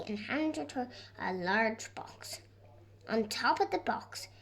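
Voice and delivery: plain reading voice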